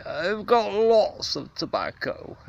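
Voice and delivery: high, slow theatrical drawl; lofty stage-knight delivery; Sir John Gielgud. Retold or imitated. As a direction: savour it like a grand old actor. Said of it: "as Sir John would say".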